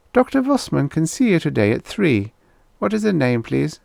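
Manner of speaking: a crisp office clerk taking a booking; receptionist on the phone. A narrator's reading.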